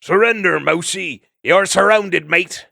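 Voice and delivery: high, loud gruff challenge; rough-voiced otter calling out; gruff otter shout. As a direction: bellow a mock surrender demand. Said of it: "A gruff voice responded".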